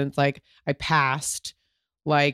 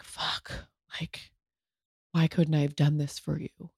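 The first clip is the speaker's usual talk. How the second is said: much softer, breathy whispered self-blame; anguished whisper; 7 dB quieter than usual. Retold or imitated. Wife whispering guilt to grieving husband, hushed. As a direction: whisper it as if ashamed to say it. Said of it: "you're just like".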